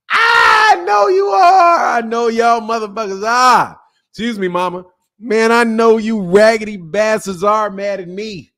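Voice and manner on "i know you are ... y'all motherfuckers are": high, loud, slowed yell through laughter; mock shout. Real, not acted. Streamer hollering at his haters through laughs, loud and slow. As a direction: holler it like a cartoon villain.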